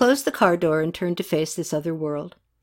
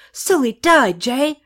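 loud, high angry disbelief; angry cry; 7 semitones higher, 5 dB louder, slower than usual. A narrator's reading. Katie raging at Jay over the patient's death. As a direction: throw the news at him. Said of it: "She couldn't contain her anger".

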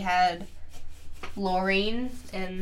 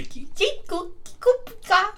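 much higher, chirpy critter voice; cartoon creature squeak; 11 semitones higher than usual. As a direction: chirp it like a tiny pocket monster. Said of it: "it was like".

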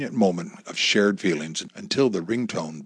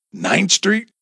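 high, breathy shocked question; gasped; 10 semitones higher than usual. A narrator's reading. Wife shocked the gang was local, gasping. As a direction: gasp it out in disbelief. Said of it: "gasped Amy".

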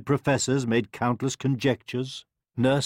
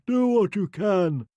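high-pitched yawned command; weary king mid-yawn; 9 semitones higher, 3 dB louder than usual. A narrator's reading. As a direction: say it through a put-on yawn. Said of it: "the king yawned".

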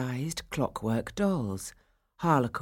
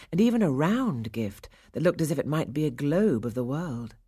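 ordinary delivery, nothing marked

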